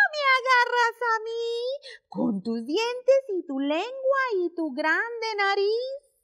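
teasing monkey voice. Acted. Monkeys taunting the crocodile, Spanish.

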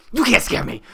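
high, loud mock bravado; defiant shout. Player taunting the scary game, defiant shout. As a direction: yell it at the game.

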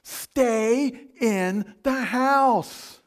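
drawn-out booming order; preacher thundering stay in the house; slow loud command. Acted. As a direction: hammer each word slowly.

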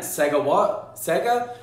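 baffled, Sega rising high in disbelief; mock puzzled repeat of the word; confused parent. Parent baffled by homework jargon, mock confused.

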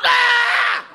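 short high yelp of a fleeing nun; a startled scream on the run; mock nun scream.

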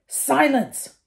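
high, loud, cutting order; cold command. Acted. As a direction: shut the boy up with ice. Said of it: "Snape said coldly".

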